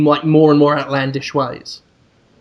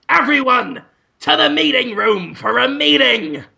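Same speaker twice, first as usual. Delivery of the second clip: higher-pitched, commanding call to staff; Willem Dafoe; 5 semitones higher than usual. Acted. New boss summoning staff to a meeting, commanding call. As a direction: call it out like a self-important boss. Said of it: "And Willem Dafoe says".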